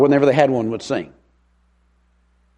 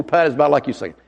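ordinary delivery

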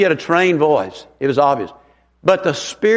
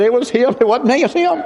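defensive; 6 semitones higher than usual. Retold or imitated. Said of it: "wanted to point to the pastor".

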